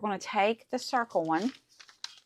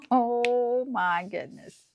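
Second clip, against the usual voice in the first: slow, higher and louder gush; delighted; 5 semitones higher, 5 dB louder, much slower than usual. Real, not acted. Crafter gushing to viewers over the result, slow thrilled gush. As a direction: let it out slowly, thrilled.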